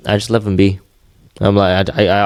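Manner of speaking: ordinary delivery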